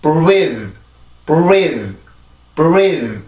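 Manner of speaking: "breathe" with B leading into a rounded R, like "buweedh"; long stressed "breathe" with B before the R glide; B put back. Shown as a demonstration. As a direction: add the B onto rounded "reathe". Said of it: "비발음이 들어가죠".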